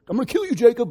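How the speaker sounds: high-pitched sibling threat; hammed-up empty threat; mock threat. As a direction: yell it like a squabbling brother, half in jest. Brother's familiar empty threat, acted.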